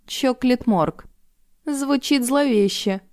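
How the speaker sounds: ominous. A narrator's reading. Ominous reading of name.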